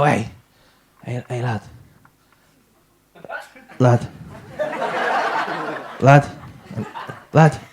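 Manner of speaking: low, slow dazed stoner calling; stoned mate. Retold or imitated. Stoned student calling his mate from the sofa, low and dazed. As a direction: drawl out the lads like a stoned friend.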